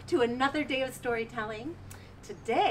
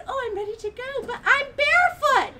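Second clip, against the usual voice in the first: much higher, louder on barefoot; delighted then dismayed princess; bright girlish voice; 14 semitones higher, 9 dB louder than usual. Acted. Rindersella in new gown.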